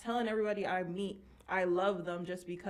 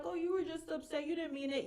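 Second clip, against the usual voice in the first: mock mom voice, pitched up; imitating mom; 8 semitones higher, 4 dB quieter than usual. Mom waves away her daughter's angry words, imitated higher-pitched. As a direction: play a mom brushing it off, higher than usual.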